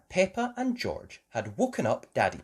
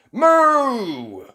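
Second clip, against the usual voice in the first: loud, high bellowing moo; bull's shout; 13 semitones higher, 12 dB louder than usual. Acted. Mister Bull bellowing down from the roof, loud. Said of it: "shouts Mister Bull".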